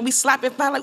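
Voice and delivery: ordinary delivery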